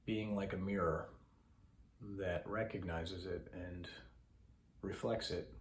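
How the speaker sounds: soft, measured voice with long pauses; guided meditation. Acted. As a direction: guide listeners gently into breath awareness.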